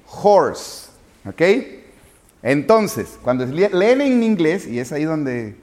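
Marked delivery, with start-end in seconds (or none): 0.1-0.9 horse with a strong j-like h, "jors"; higher horse with the h clearly voiced out; sounded English h. Shown as a demonstration. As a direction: push the h at the start of horse. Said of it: "suena como J".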